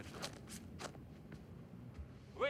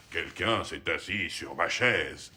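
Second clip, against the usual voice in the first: papa bear's big voice; 5 semitones lower, 4 dB louder, slower than usual. A narrator's reading. Father reading to baby, slow low papa bear voice. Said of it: "avec sa grosse voix".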